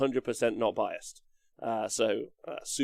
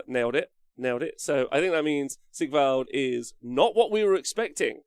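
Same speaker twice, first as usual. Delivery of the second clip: ordinary talk, nothing marked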